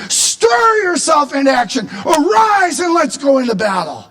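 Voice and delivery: high, forceful summons; rousing imperative cry; commanding battle call. Acted. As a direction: urge God to rise and fight. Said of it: "carries the weight of an imperative".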